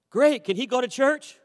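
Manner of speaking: put-on boy asking the pastor, excited and high; eager kid. Kid's eager reply.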